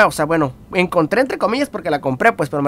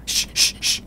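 whispered shushing to shoo someone off; breathy hiss of shh, unvoiced; hushing shh; 7 dB quieter, much slower than usual. Shushing the pushy classmate away, whispered. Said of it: "Yo casi le hacía así como".

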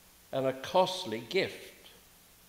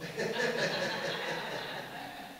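breathy higher laugh after the joke; amused laugh; 6 semitones higher, 6 dB quieter than usual. Real, not acted. Preacher recalling seminary prank, real amused laugh. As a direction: laugh at your own cheek.

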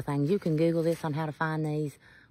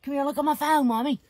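calling out; 6 semitones higher than usual. Real, not acted. Calling someone over to look.

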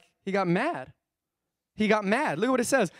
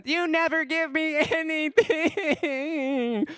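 put-on high, slow whiny child voice, breaking into a laugh; whining toddler; 9 semitones higher, much slower than usual. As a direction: imitate a toddler whining at dad. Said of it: "you know, toddler whining".

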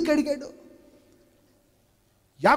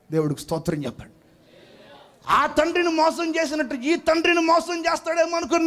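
ordinary delivery

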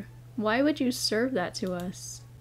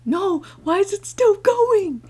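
high panicked shout; scared horror game streamer; 9 semitones higher, 9 dB louder than usual. Real, not acted. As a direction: yell in terror at the screen.

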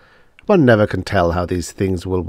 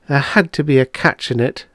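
higher-pitched, mildly bitter retort; 7 semitones higher than usual. A narrator's reading. Patient learns the cure has a price, mildly bitter. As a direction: say it with a touch of bitterness. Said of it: "Miss Twilly said bitterly".

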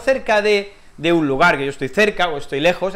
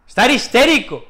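raised high, loud and hammed up; loud emphatic; 6 semitones higher, 6 dB louder than usual. Shown as a demonstration. Teacher loudly presenting the phrase to students. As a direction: announce it with a burst of energy. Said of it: "lo que os quiero enseñar".

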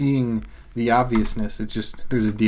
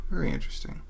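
usual speaking voice

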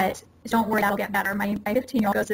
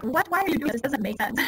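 higher-pitched, incredulous adult questioning; mock bafflement; 6 semitones higher than usual. Adult questioning an irrational child, mock exasperated bafflement. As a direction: act out an exasperated grown-up who can't understand.

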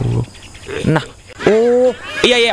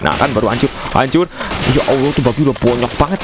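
ordinary talk, nothing marked

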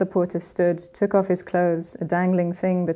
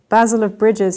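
loud triumphant cry; cried out; 3 semitones higher, 7 dB louder than usual. Acted. Porter finally crying out the name, loud. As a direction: blurt the name out loud at last. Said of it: "he cried at last".

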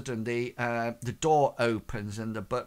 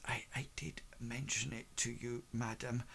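quiet butler aside; 14 dB quieter than usual. Acted. Butler murmurs aside to lady.

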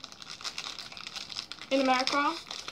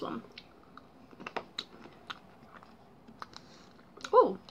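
sucking on hard candy; talking around a sweet; candy in mouth; 3 semitones higher, 12 dB quieter, faster than usual. Real, not acted. Seen 2: Puts candy in mouth at -4; then sucks, reacts, hand on chest.

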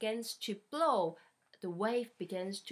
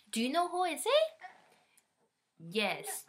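ordinary delivery, nothing marked

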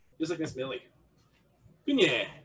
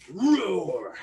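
drawn-out roar, rising then falling; tiger roar. Shown as a demonstration. Coach doing stretch kicks with animal sounds, roar. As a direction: roar through the kick. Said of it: "nice roar".

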